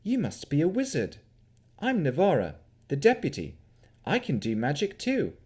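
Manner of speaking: lightly voiced friendly deputy. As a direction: introduce yourself with light curiosity.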